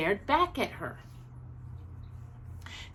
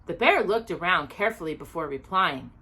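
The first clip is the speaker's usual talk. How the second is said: usual speaking voice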